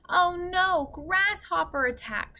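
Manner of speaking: a light read-aloud scream, not full terror; mild mock scream. A narrator's reading. Kate cries out to the class as stones hit the roof, mild scream. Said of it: "screamed Kate".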